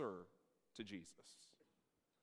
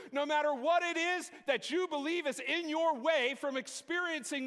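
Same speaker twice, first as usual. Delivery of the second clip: rising, louder, higher preacher's build; preaching at full force; 7 semitones higher, 3 dB louder than usual. Acted. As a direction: drive the congregation up to a climax.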